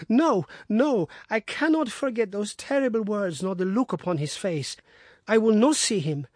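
high, pleading, wounded voice; anguished wife. Acted. Wife pleading to Watson about her husband, high and anguished. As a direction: plead the depth of her love.

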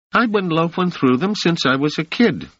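put-on high, cocky street kid; street boy Rache. A narrator's reading. Rache answers.

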